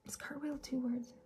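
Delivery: hushed whisper to oneself; whispered aside. Real, not acted. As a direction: whisper a quick question to yourself. Checks spelling to herself while writing.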